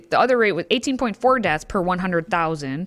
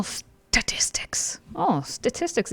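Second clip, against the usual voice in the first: whisper; 2 semitones higher, 6 dB quieter, much slower than usual. Pretend. Mocking aside about statistics.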